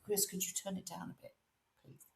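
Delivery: a quick, hushed telling-off; mock hushed request. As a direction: tell someone to pipe down under your breath. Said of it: "your face and said".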